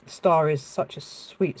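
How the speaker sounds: ordinary delivery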